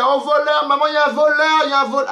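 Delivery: cri suraigu et fort, joué; faux cri au voleur. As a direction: crier au voleur comme une alarme feinte. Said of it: "j'allais crier comme jamais". (faux cri d'alerte au voleur, très aigu)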